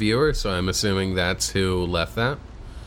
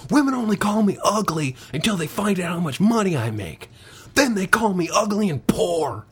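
hammed-up movie star delivering a joke; Nick Cage impression; 8 semitones higher than usual. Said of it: "like Nick Cage".

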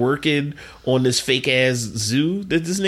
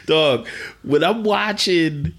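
ordinary talk

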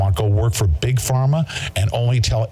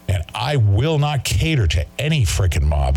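usual speaking voice

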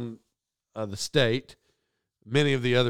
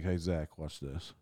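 much softer, lower, sneaky lean-in; conspiratorial aside; 10 semitones lower, 11 dB quieter, slower than usual. Retold or imitated. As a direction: hush it like a secret tip. Intern leaning in to whisper.

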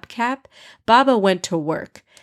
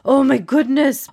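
alarmed; 6 semitones higher, 4 dB louder than usual. Acted. Father pokes daughter with pin.

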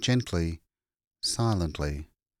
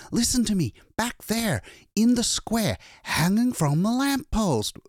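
high, urgent pleading; frantic, raised-voice appeal; desperate insistence; 14 semitones higher, 4 dB louder than usual. A narrator's reading. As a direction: beg them to listen, pitched way up. Desperate man insisting on the body.

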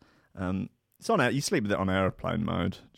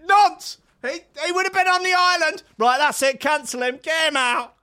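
very high, loud hysterical yell; hammed-up fury, yell it; mock outrage shout; 9 dB louder than usual. Condemning a disliked pundit, very high and loud mock fury. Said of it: "I'd be like".

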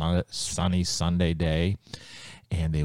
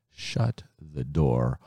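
ordinary delivery, nothing marked

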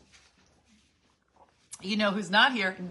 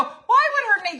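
much higher, louder, incredulous; retold disbelief; 11 dB louder than usual. Narrator reacting to Jurgen's guess, incredulous and high. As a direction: squeal in disbelief. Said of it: "And I go".

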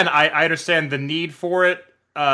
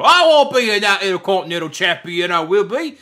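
high, hammed-up English accent; bad mock-Cockney chimney sweep, starting loud; chimney sweep impression; 6 semitones higher, 5 dB louder than usual. Podcaster imitating British champions for a laugh, hammed-up English accent. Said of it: "you had me at the chimney sweep, uh, impression".

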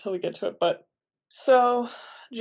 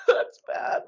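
laughing. Real, not acted. Laughing while reacting to torture.

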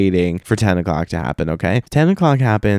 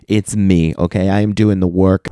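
ordinary delivery

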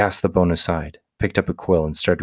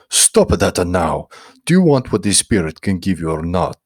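stern scolding; 5 dB louder than usual. Acted. Priest scolds praying Guido.

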